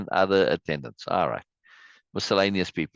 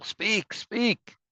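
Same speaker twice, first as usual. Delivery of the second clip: high-pitched mock crowd cry; high acted chorus of citizens; crowd's reply; 12 semitones higher than usual. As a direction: chant it back in a high stage voice. Reads the crowd's answering cry.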